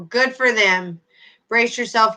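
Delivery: usual speaking voice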